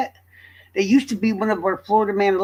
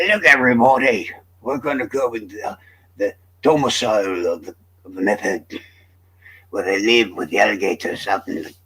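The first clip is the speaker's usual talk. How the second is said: a lightly put-on backwoods Florida accent, lower; Florida swamp accent; 10 semitones lower than usual. Hosting a pretend video tour to the meth heads' swamp, in a mild put-on Florida accent. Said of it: "I probably will be making that accent too".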